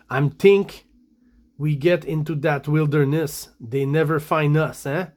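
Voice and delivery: thick French Canadian English, th turned hard; French Canadian accent. Acted. Reading the comic kidnapper's lines to viewers, French Canadian accent. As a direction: read it as a French Canadian woodsman. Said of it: "French Canadian speaking English accent".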